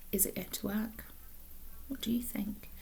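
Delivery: whispered suspense question; hushed. A narrator's reading. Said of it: "hushed question to listeners".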